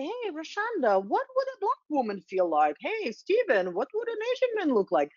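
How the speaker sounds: high-pitched, put-on friendly questions; mock polite asking. Mimicking people asking minority colleagues for their view, mock polite. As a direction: mimic someone singling out a colleague, voice lifted high.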